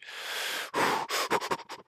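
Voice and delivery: long, shaky-paced puffing breaths; acted steadying breaths; nervous exhale. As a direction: blow out air to psych yourself up. Acts psyching himself up to approach.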